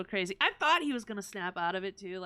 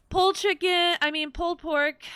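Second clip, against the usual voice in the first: loud, high burst of enthusiasm; excited gushing; 9 semitones higher, 10 dB louder than usual. Real, not acted. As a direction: gush loudly about favourite food. Gushing about favourite barbecue.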